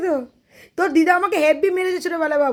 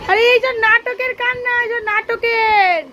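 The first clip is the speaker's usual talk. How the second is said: mocking; 8 semitones higher, 5 dB louder, slower than usual. Real, not acted. Mocks son's fake crying.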